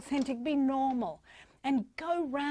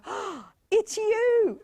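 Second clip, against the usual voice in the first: high, loud, long-drawn gasp of joy; delighted self-greeting; 10 semitones higher, 6 dB louder, much slower than usual. Acted. Single person greeting their mirror, loud gushing delight. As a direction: gasp and gush it very slowly, pitched high. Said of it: "look into the mirror and go".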